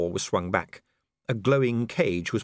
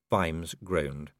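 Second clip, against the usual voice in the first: usual speaking voice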